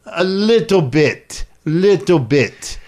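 put-on slow, halting Italian-accented English; fake Italian accent. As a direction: play a tourist-friendly Italian who barely speaks English. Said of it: "I pretend I'm Italian".

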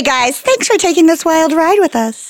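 ordinary delivery

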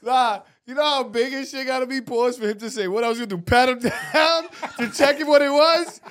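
high, loud, laughing quote; loud laughing retort. Retold or imitated. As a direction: shout it through laughter. Acting out a reply.

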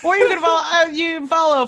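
high and giggly; words bubbling with laughter; laughing. Real, not acted. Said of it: "There's just lots of laughing happening".

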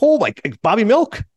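high, drawn-out surprise, lightly acted; retold astonished recognition. Host acting Marty recognizing De Niro, mock astonished. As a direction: imitate someone suddenly placing an old face.

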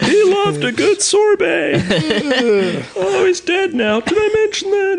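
very high, drawn-out imitated holler; screaming deaf neighbor. Host as deaf neighbor ordering ice cream, high imitated scream. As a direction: play the oblivious deaf man, squealed and slow. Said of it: "he just screams from all that tractor work".

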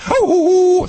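very high excited oh; high yelp of joy; happy dog hearing. Retold or imitated. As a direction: squeal it like a delighted puppy. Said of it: "the dog is hearing".

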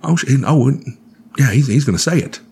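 hyped fan who can't contain himself; excited moviegoer. Retold or imitated. Fan behind him in the theater, eager excited rush. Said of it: "the guy behind me went".

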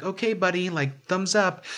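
high, indulgent thumbs-up voice; placating him like a kid; humouring friend. Retold or imitated.

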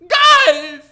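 very high, very loud mock-hysterical shrieking; crying screams. Girl watching guys fight, hammed-up hysterical screaming. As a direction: shriek it like a girl begging them to quit. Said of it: "like, you're crying".

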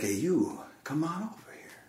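put-on hushed Greek-accented beckoning. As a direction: imitate an old Greek man calling softly. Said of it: "he had a Greek accent".

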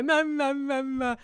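high, wailed "Mum, mum"; baby wail fading off; imitated baby cry. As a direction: imitate a crying baby's repeated bleat. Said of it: "when she cries, when, you know, when they go".

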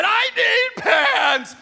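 high, slow, wailing with a laugh; mock wailing child. Father imitating his daughter's sleepwalking meltdown, wailing. Daughter melts down, parents retell.

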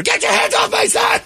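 hammed-up furious mom yell; screaming mom. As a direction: shriek like a frantic mother.

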